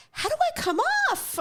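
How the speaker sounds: high, incredulous question; offended disbelief. Retold or imitated. To the agent who laughed, a high offended squeak. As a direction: squeak it out, truly affronted. Retelling her offended reply.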